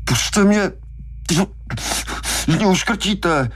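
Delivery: very high, squeezed, gasped plea; being strangled. A narrator's reading. Hooper begging Brody to let go, strangled. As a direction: beg for air through a crushed throat. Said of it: "Brody ho stiskl ještě pevněji".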